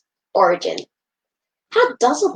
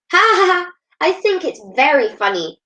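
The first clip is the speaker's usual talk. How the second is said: laughing; 7 semitones higher than usual. A narrator's reading. Matteo laughs at friends he tricked, gleefully. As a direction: cackle at the friends you fooled. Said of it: "laughed Matteo".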